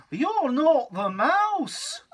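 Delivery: high, loud and slow; high drawn-out storybook accusation; slow accusing child. Acted.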